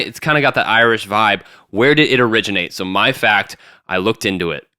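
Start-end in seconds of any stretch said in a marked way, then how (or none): none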